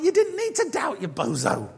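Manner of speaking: high start dropping into a teasing jibe; playful chiding. Acted. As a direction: rib him fondly for doubting. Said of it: "I don't think there was, like, anger".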